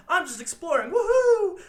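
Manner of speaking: high sing-out ending in a whoop; mock excitement. Retelling Dora out exploring, mock excited.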